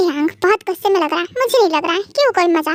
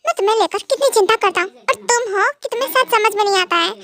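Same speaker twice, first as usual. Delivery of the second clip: ordinary talk, nothing marked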